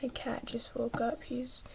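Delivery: hushed whisper so the cat stays calm. Real, not acted. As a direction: drop to a soft whisper. Cat just woke up nearby.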